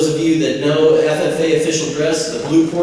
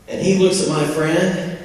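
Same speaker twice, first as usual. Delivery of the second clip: ordinary talk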